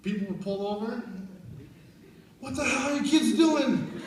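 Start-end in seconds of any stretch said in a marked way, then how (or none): 2.4-4.0 loud, high mock outrage; alarmed yell. Drivers pulling over at a pretend fight, alarmed yell. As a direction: imitate a shocked passerby yelling at kids. Acts out drivers yelling at kids.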